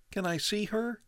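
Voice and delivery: put-on high, boyish protest; boy questions the father, upset; young boy. A narrator's reading.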